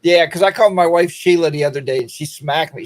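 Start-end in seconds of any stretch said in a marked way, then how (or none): none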